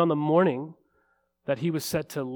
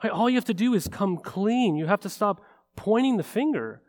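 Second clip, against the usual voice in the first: louder, higher pulpit preaching; impassioned preaching; 5 semitones higher, 4 dB louder than usual. Real, not acted. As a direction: drive the message home to the congregation. Preaching at full force.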